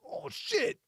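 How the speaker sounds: high, soft put-on alarm; acted high-pitched dread; mock dismay. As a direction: let it out high and small. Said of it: "You like".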